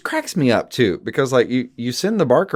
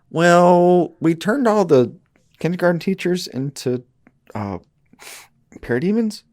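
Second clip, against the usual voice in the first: sheepish minion; 8 semitones higher than usual. Retold or imitated.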